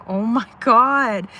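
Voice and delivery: loud, slow, drawn-out astonishment; acted amazement. Retelling cats all eating her tuna, amazed outburst.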